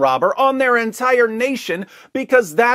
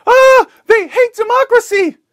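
high, loud hysterical shriek; mock scream; 9 dB louder than usual. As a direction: shriek it like a panicked reporter. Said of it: "and screams".

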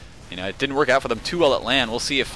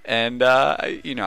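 ordinary talk, nothing marked

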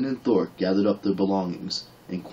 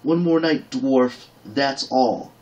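high, loud curt anger; an angry warrior maid setting terms; flaring up; 5 semitones higher, 4 dB louder, slower than usual. Acted. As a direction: bite off each word at him. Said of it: "Then she flared".

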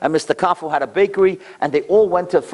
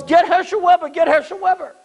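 urgent calling; 11 semitones higher than usual. Retold or imitated. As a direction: call out urgently for Herschel. Crowd calling for help.

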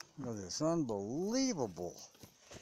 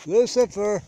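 calling the dog; 7 semitones higher, 13 dB louder than usual. Real, not acted. Calling his hiding dog.

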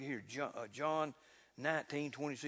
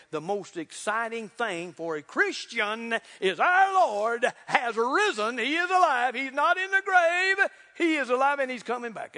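high, loud, ringing preacher's cry; shouting the good news; preaching at full force; 8 semitones higher, 11 dB louder than usual. Real, not acted. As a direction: lift the church with every line. Second preaching climax.

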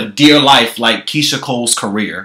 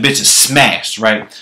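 usual speaking voice

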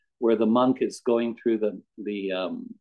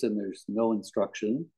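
ordinary talk, nothing marked

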